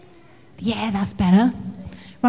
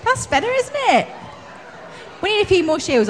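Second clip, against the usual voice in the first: ordinary delivery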